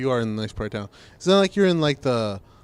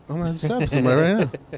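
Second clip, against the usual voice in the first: fast, higher rushed threat; rushed mock threat; 4 semitones higher, 4 dB louder, much faster than usual. Telling his friend about the rude guy, rushed mock threat. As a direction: blurt the threat out fast, half joking. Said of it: "I'm like".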